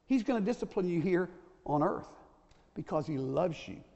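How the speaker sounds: ordinary talk, nothing marked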